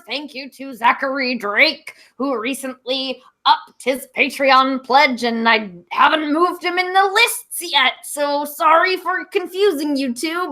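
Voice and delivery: ordinary delivery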